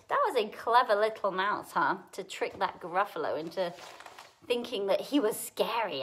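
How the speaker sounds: ordinary delivery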